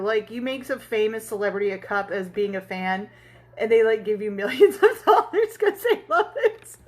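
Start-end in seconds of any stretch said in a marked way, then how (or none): 3.6-6.8 words breaking into real laughter; laughing. Real, not acted. Telling viewers about the dream's fantasy, laughing. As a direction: laugh as you say it.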